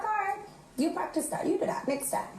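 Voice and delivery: high, soft teasing mom; mock mom daring her to try it; mother's voice. Mother teasing daughter about fighting back, high and soft. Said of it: "She's like".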